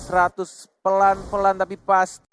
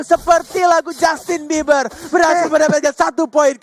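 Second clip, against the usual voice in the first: excited; 8 semitones higher, 5 dB louder than usual. Real, not acted. Caster hyping the kill.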